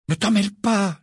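ordinary delivery

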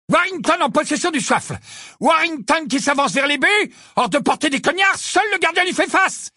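high-pitched live match call; excited commentary. A narrator's reading. Lee calling Warrington's charge at Ron, excited and high. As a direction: raise the tension as the attacker closes.

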